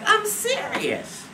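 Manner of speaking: very high, slow retold insistence; earnest insistence. Woman to her laughing friends, high-pitched insistence. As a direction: squeal it slowly to be believed.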